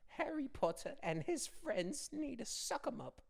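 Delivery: high, slow elf voice; Dobby voice. Pretend. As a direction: play the house-elf. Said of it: "Be like".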